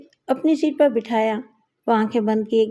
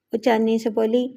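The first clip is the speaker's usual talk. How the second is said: plain reading voice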